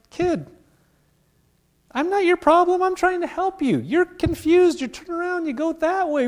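a hint of an exasperated parent setting a kid straight; parent to toddler. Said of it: "You're like".

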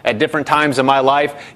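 ordinary talk, nothing marked

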